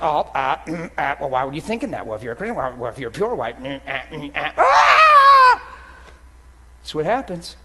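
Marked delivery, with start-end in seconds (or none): 0.0-4.0 low, hesitating mock devil voice; devil taunting. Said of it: "And the devil's just". 4.1-5.6 sudden very high, loud drawn-out mock scream; hammed-up devil howl that suddenly leaps up high; devil's shriek. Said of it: "And the devil's just".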